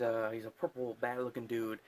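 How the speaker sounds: normal talking voice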